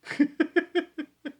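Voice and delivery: high, light giggle. Real, not acted. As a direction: giggle in a quick high run. Laughs after the disagreement.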